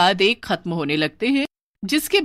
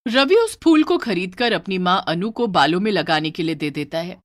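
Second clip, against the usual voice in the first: plain reading voice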